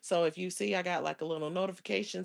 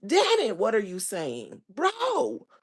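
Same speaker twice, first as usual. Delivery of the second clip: exasperated outburst; 3 semitones higher, 7 dB louder, slower than usual. Retold or imitated. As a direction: yell at a speaker who won't get to the point.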